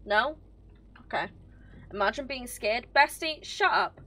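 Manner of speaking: ordinary talk, nothing marked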